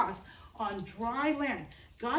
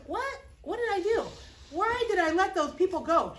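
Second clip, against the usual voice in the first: a ruler suddenly kicking himself, higher and higher; Pharaoh's dismay; 6 semitones higher than usual. Acted. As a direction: pitch jumps up high in shocked regret. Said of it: "he kind of goes like".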